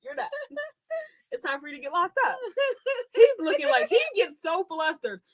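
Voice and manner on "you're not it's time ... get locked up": mock cop. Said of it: "I'd be like".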